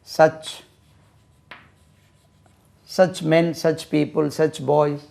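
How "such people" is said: ordinary talk, nothing marked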